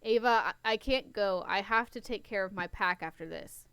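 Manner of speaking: ordinary delivery, nothing marked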